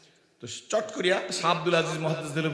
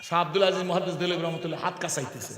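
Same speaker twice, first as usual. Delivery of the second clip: usual speaking voice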